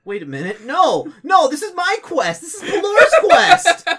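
very high, loud, laughing protest; the priest claiming the quest in panic; indignant squeal. Retold or imitated. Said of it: "all of a sudden he's like".

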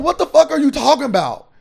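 high, loud disbelieving outburst; what the hell, loud and high; incredulous retort. Retold or imitated. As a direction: fire back in shocked disbelief.

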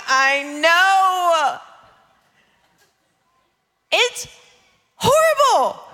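high, drawn-out acted sympathy; hammed-up shared misery; mock commiserating wail. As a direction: wail along with an upset child. Mother commiserating with upset child.

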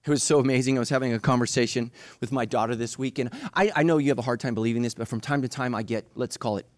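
usual speaking voice

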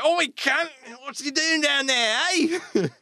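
high, hammed-up Australian thug; Australian accent. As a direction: call down in an exaggerated high-pitched Australian accent. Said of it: "Australian accents".

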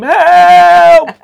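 long high screamed help. Shown as a demonstration. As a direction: scream it like you're plunging. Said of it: "I might have to sort of act this out".

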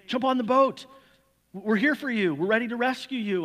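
calling out. Retold or imitated. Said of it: "cry out and say".

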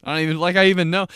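ordinary delivery

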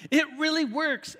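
cheesy ad promising it works; mock ad hype. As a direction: pitch it like a too-good-to-be-true ad. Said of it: "exclamation point".

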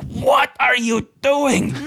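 high, drawn-out outraged disbelief; slow, very high-pitched incredulous cry; mind-blown. Acted. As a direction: stretch each word in astonishment. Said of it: "mind-blown expression".

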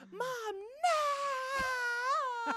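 imitated high wail on a long-held NO; hammed-up child's crying scream. Mom imitates daughter sent to jail, scream crying. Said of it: "starts scream crying".

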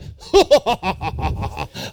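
villainous cackle; evil laugh. Acted. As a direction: cackle like a scheming king. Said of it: "I picture him with his evil laugh like".

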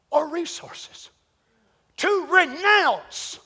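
ordinary talk, nothing marked

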